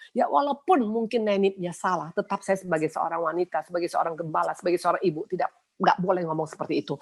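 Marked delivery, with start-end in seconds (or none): none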